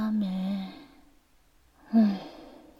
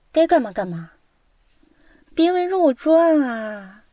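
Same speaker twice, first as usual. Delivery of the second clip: mild annoyance; 3 semitones higher than usual. Acted. Student to hovering roommates during a phone call, mildly annoyed. Shooing nosy roommates away.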